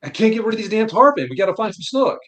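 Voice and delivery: dad yelling in frustration at tarpon ruining the snook trip; angry dad cursing. Retold or imitated. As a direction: imitate a fed-up father swearing at the fish. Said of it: "He's like".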